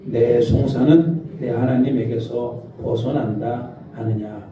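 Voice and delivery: leading the congregation through the verse slowly; slow unison scripture reading. Shown as a demonstration. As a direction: read it slowly together, word by word. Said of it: "시작".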